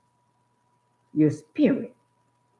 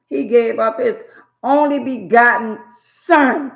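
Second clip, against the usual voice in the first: higher, louder, slower; soaring preacher's line; preaching swell; 6 semitones higher, 7 dB louder than usual. Real, not acted. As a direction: swell it to a climax. Sermon climax.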